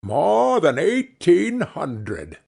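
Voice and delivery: slow, grand spirit voice; an ancient spirit speaking slowly; slow ghostly answer. A narrator's reading. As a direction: let the number roll out slowly. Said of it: "said the ghost".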